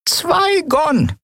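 put-on high croaking old woman shout. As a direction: bark the price like an old crone. Old chestnut seller demands payment.

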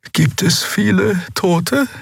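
high, slow, frightened asking; fearful question. A narrator's reading. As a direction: dread the answer as you ask. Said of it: "die sich merklich vor der Antwort fürchtete". (ängstlich, hoch und langsam gefragt)

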